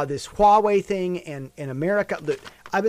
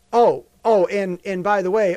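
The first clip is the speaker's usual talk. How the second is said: ordinary talk, nothing marked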